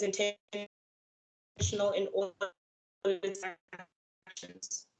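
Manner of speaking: breaking up on a bad connection; choppy, cutting-out call audio; glitchy audio. Real, not acted. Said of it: "you were just, um, hawking a little bit".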